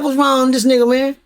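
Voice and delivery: can't believe what he's seeing; baffled disbelief. Retold or imitated. Narrator baffled watching the old man start crying.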